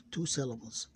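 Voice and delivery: ordinary delivery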